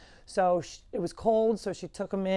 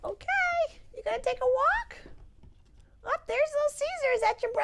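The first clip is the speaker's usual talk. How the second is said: much higher, encouraging coo; cheering pet talk. Real, not acted. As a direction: urge the goat on sweetly. Cooing at the goat.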